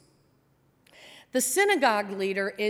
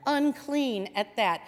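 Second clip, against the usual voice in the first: ordinary talk, nothing marked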